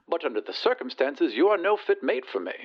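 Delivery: high-voiced lady dismissing a suitor; prim rejection turning to a threat; haughty lady.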